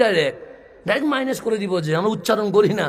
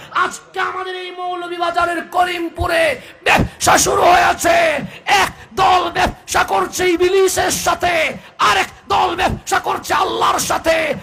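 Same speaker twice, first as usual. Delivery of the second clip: fiery preaching; 10 semitones higher than usual. Acted. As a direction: preach it out to the crowd at full force. Preaching at full force.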